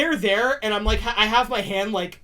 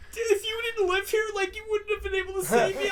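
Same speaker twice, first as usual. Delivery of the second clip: high, half-shouted grateful outburst; overwhelmed thanks; 11 semitones higher, 5 dB quieter than usual. Retold or imitated. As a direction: blurt out thanks, voice cracking high. Thanking rescuer after bike crash.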